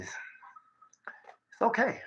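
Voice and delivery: slow whisper. Real, not acted. Speaker reassuring a viewer who doesn't know bots, slow whisper. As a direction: whisper it like a secret comfort.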